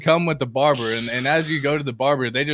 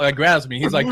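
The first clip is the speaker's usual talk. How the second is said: usual speaking voice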